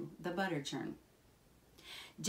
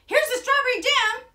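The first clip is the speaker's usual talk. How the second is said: very high, loud cry; excited shout; 13 dB louder, faster than usual. A narrator's reading. Jack announces the strawberry jam to his mother, excited shout. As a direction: yell it in triumph, pitched way up. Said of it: "shouted Jack".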